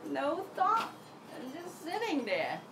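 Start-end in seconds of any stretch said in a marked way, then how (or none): none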